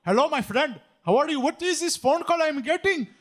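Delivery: mock Indian caller voice; Indian accent. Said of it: "speak to them with an Indian accent".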